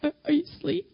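mock tearful, halting middle-of-the-night call; imitated upset caller. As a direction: act out a friend calling upset at three a.m. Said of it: "she would be like this".

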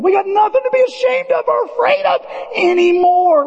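high, shouted declaration; preaching shout. Real, not acted. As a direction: proclaim it to the back row. Repeats the climax louder.

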